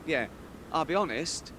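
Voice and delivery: usual speaking voice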